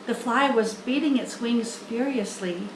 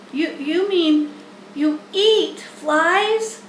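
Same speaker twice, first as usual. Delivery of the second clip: slow, stammering horrified gasp; 6 semitones higher, 4 dB louder, slower than usual. Acted. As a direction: recoil in disbelief, halting. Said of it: "gasped Wilbur".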